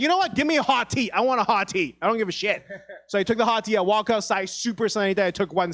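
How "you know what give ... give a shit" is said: high, loud indignant outburst; so mad. Retold or imitated. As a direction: re-enact a defiant, angry order at the counter. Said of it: "I was so mad".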